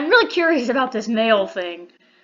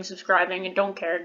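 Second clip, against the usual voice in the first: normal talking voice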